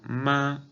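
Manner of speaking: "ma" high and level; first tone. Shown as a demonstration. Teacher giving the word for mother, "ma" high and level. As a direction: hold "ma" steady on a high pitch. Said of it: "esta palabra en primer tono".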